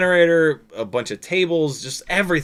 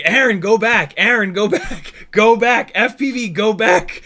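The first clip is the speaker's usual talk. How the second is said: high, loud repeated calls; raised, urgent call over the comms; insistent director; 7 semitones higher, 6 dB louder than usual. Retold or imitated. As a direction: imitate a director hollering someone back. Director repeatedly calling pilot back.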